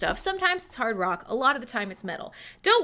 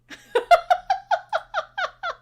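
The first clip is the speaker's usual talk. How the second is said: high loud cackle; 5 dB louder than usual. Real, not acted. Streamer cackling at Mandy the bot.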